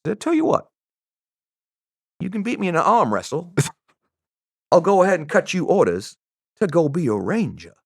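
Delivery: high, lilting mock Southern voice; Southern accent. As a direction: pitch it up as a Southern gent making a wager. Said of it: "I don't have a very good Southern accent".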